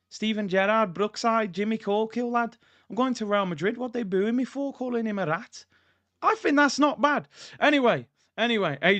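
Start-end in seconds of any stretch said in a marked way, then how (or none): 0.1-5.7 put-on Scouse accent; Liverpool accent. Shown as a demonstration. Showing the chat his Liverpool accent, hammed-up Scouse. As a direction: demonstrate a mock Scouse accent. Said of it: "my Liverpool accent then?".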